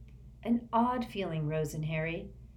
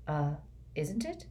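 uncertain; 6 dB quieter than usual. A narrator's reading. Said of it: "said Harry uncertainly".

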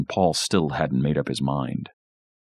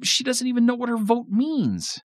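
put-on high-pitched kid's whine; whiny, indignant and much higher; whining protest. A narrator's reading. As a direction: complain like a cheated older brother. Jonathan keeps whining about Tina's vote.

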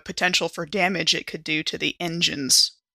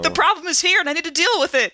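insistent; 10 semitones higher, faster than usual. Acted. Character insists on staying put.

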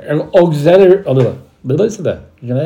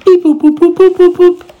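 robot beeps; 6 dB louder than usual. Pretend. Jingle sound effect for ad.

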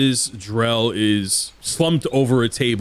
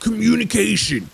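mocking demon; 11 semitones higher than usual. GM voices demon Reginald.

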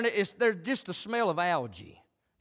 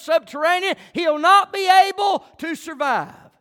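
loud, high pleading shout; Amos pleading with God; 10 semitones higher, 10 dB louder than usual. Retold or imitated. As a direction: beg God to spare his people. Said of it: "Now, Amos said".

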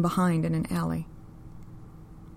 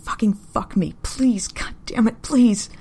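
loud, higher, cracking plea; desperate pleading; 4 semitones higher, 7 dB louder, slower than usual. A narrator's reading. Woman begging her rough lover, loud desperate crying plea. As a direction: beg him, voice breaking into a wail. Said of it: "she's pleading and crying".

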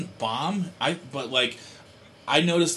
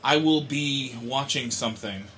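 ordinary delivery

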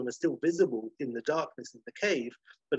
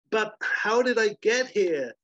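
higher, louder, slow pleading; high pleading girl; 8 semitones higher, 5 dB louder, slower than usual. Acted. Sarah pleading.

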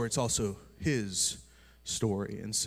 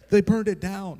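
higher, louder mock wail; imitating wailing wife; 9 semitones higher, 8 dB louder than usual. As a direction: cry it out like the heartbroken wife. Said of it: "bawling her eyes out".

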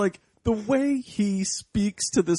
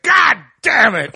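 louder mock curse, laughing; laughing mock shout; 8 dB louder than usual. Hermit seeing the kids' fire, with a laughing mock shout. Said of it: "he's like".

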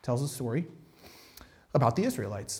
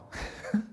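a soft huffed laugh under the breath; quiet breathy laugh; 10 dB quieter than usual. Real, not acted. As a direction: let out a quiet, unvoiced chuckle. After his own joke.

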